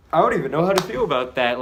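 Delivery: high, loud, fast and stunned; real baffled excitement; bewildered outburst. Real, not acted. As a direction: blurt it out in disbelief. Reacting to an odd chapter.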